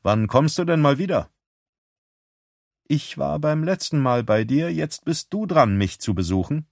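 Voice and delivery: lightly voiced sister on the phone; Beth's voice.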